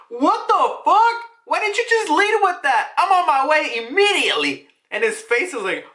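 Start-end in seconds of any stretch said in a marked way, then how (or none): 0.1-4.7 high, loud indignant outburst; shocked outburst. Acted. Garp reacting to news that Roger is on his way, shocked outburst. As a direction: blurt it out high and urgent, outraged.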